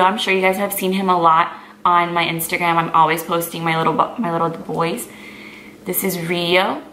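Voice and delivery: normal talking voice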